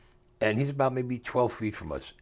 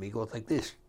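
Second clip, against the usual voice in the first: ordinary talk, nothing marked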